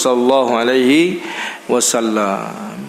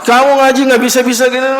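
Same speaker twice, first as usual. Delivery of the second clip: much higher, fast angry scolding; angry teacher; 9 semitones higher, 3 dB louder, faster than usual. Acted. Teacher scolding a pupil who can't recite, angry and fast. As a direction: snap at the pupil in frustration. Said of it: "Ah, marah gitu".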